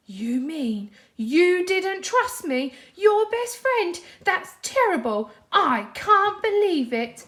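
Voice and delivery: high, loud, slow hurt outburst; wounded and furious at a friend; upset Patrick. A narrator's reading. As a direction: Patrick upset that SpongeBob didn't trust him.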